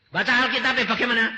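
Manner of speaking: high, loud, fast "Baca Alkitab" as a preaching cry; loud outburst. Acted. ("Baca Alkitab" keras, tinggi dan cepat)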